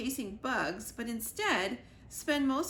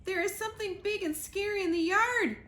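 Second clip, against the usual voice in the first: high, louder panicked squirrel; breathless gasp; 10 semitones higher, 4 dB louder than usual. Acted. Squirrel gasping to the chicks in a panic. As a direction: gasp it out, scared and winded. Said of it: "gasped the squirrel".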